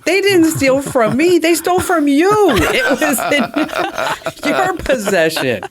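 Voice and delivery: high, slowed, half-laughing protest; mock indignant. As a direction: argue it out with exaggerated disbelief. Arguing back with dry cleaner.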